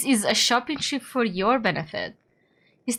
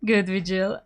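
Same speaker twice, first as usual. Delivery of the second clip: ordinary delivery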